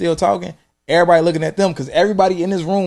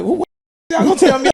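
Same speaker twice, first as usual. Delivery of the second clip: high, loud disbelieving outburst; incredulous; 4 dB louder, faster than usual. Retold or imitated. An employee told his pay is docked, loud incredulous protest. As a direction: act shocked at the boss.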